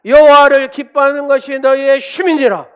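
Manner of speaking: thundering declaration, each phrase stretched out; full-force proclamation. Shown as a demonstration. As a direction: proclaim the verse at the top of your voice. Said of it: "그렇게 선포할 때".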